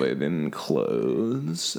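low, very slow fried drawl; vocal fry. Pretend. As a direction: stretch it out in creaky fry. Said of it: "Our media voice?".

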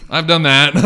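shocked exclamation. Real, not acted. A viewer recognizing his own behavior, shocked and loud. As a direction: blurt it out, stunned at himself. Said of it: "That's fucked up".

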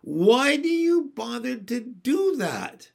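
high, drawn-out amused question. A narrator's reading. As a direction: tease in a high little voice. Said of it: "in her soft, amused voice".

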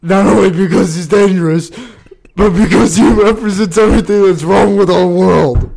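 loud, intense heroic voice; brooding hero thinking aloud; Batman inner voice. Acted. Batman's inner monologue, acted.

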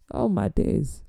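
ordinary talk

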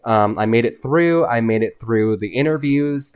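ordinary talk, nothing marked

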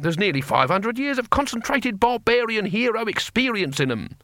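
put-on high, indignant old warrior; Cohen, old barbarian. A narrator's reading.

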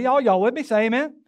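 rally the church for a response; rousing call-out. Real, not acted. Calling on congregation to respond.

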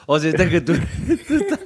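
pregunta rota por la risa, cada vez más aguda; entre risas. Real, not acted. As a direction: laugh through the question, voice climbing high. Se ríe mientras pregunta. (riéndose al hablar)

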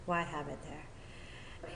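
slow, soft repeat; quiet reflection. Real, not acted. Speaker pondering booze in the fridge, quietly reflective. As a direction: let the question sink in, hushed. Question repeated slowly for effect.

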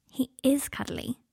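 slow delighted whisper. Acted. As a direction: breathe it in wonder. Adelaide whispering over wolf.

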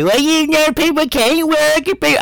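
high objector voice. Retold or imitated. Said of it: "before you say".

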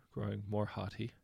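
ordinary delivery

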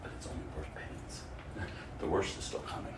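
whispered, ominous aside; hushed warning. Real, not acted. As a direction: drop to a whisper as if sharing a secret. Hushed warning of end times.

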